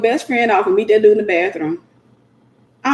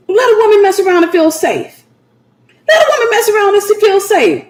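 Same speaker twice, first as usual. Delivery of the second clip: fervent refrain; 7 semitones higher than usual. Real, not acted. Preacher repeating her refrain to the women, higher and laughing. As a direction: sing it out with joy.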